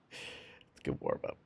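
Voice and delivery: breathy whisper, slowed down. Shown as a demonstration. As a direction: hush it like a secret. Repeats line, then whispers it.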